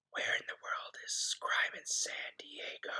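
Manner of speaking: spooky hushed whisper. Pretend. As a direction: whisper it like a secret. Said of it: "Why are you whispering?".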